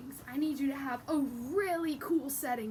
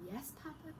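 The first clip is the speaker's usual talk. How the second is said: much softer, guilty little reply; sheepish small voice; 14 dB quieter than usual. Acted. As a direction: answer papa meekly, almost whispering. Chicken answers papa sheepishly.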